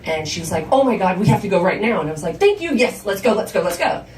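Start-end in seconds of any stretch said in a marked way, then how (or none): 0.7-2.0 loud, rushed exclamation; acted-out mother in a sudden hurry; urgent alarm. Mother convinced, urges leaving. 2.4-4.0 loud, high burst, then hurrying; acted-out impatient relief, starting high; exasperated urgency. Retelling her relief and hurry.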